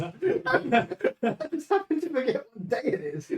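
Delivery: laughing. Real, not acted. Laughing while correcting.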